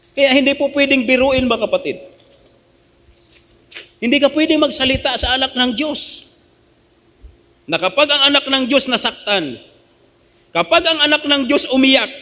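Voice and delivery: high, driving pulpit warning; fervent preaching. Acted. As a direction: warn the flock with rising fervor. Preaching at full force. (masidhing pangangaral)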